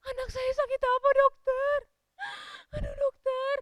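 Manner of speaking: high, loud, hammed-up sobbing; shocked tearful wail. Mother begs the doctor to name her child's illness, wailing in shock. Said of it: "Kaget mamanya".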